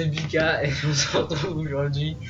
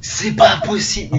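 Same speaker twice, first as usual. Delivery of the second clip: high, loud outrage at luck; outraged disbelief; 7 semitones higher, 9 dB louder than usual. Real, not acted. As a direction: cry out in disbelief. Outraged at lucky cards.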